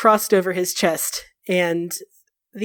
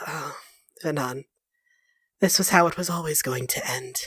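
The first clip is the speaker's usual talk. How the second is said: much softer and lower, quietly fatalistic; Solas, resigned; 6 semitones lower, 7 dB quieter than usual. Acted. Solas resigned to the battle, much softer and lower. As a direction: let it fall away, tired of fighting.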